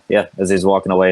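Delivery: usual speaking voice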